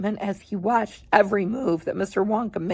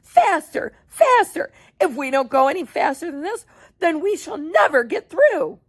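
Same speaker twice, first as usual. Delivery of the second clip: frantic urging; 7 semitones higher than usual. Acted. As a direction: push it harder and harder. Wonka urging elevator on.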